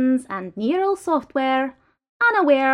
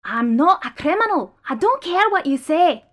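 desperate protest; 6 semitones higher, 6 dB louder than usual. Acted. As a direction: fight the verdict with rising despair. Restrained prisoner protests innocence.

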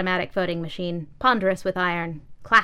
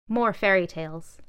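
plain reading voice

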